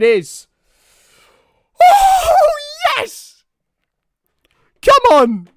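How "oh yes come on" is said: sudden high, loud cheer; real fan yell; excited shout. Real, not acted. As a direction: punch the air. Fan celebrating on the pitch action.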